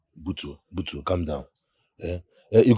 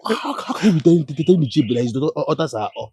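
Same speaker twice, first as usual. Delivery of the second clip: incredulous rebuke; 5 semitones higher, 3 dB louder than usual. Acted. Friend shocked at insult.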